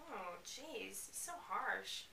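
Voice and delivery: usual speaking voice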